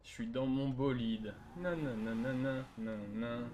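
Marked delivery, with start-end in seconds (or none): none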